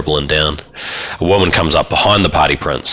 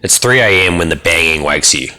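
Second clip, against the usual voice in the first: ordinary delivery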